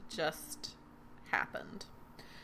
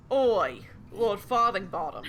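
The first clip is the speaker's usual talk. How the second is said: put-on Cockney accent; 5 dB louder than usual. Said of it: "horrible Cockney accent".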